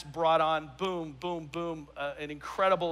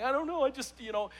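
choked up; 6 semitones higher than usual. Retold or imitated. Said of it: "and I'm getting emotional".

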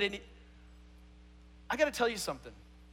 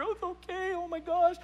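put-on fussing high school girls; 9 semitones higher than usual. Friends comforting a bawling girl at Bible study, mock fussing. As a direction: mimic girlfriends cooing over a crying friend.

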